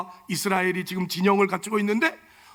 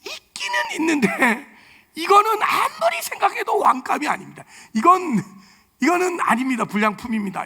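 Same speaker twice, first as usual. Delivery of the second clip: higher, earnest voiced prophet; acted prophet quote; 7 semitones higher than usual. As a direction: play the prophet declining, pitched up. Samuel voiced rejecting sons. (높은 목소리로 예언자 흉내)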